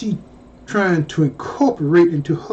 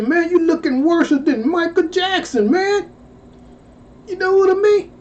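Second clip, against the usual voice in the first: much higher, teasing shout-out to Johnny; pitched-up razzing of a wasted star; mocking disbelief; 7 semitones higher than usual. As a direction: roast an old teammate. Ribbing former basketball star Johnny.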